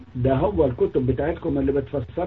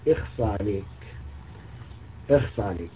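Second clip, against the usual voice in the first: low, soft disgusted tut; disgusted tsk; 5 semitones lower, 9 dB quieter than usual. Real, not acted. Scolding the prophet's words, low disgusted tut.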